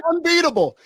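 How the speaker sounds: high, shouted fan proclamation; excited shout. Real, not acted. Hyped Stallone fan proclaiming.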